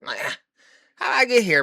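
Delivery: high, squeaky disbelief; mock bewilderment. Wondering aloud how he landed in this mess, in high mock bewilderment. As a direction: act baffled at where you ended up. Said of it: "I'd be like".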